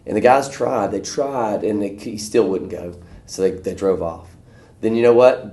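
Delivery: ordinary talk, nothing marked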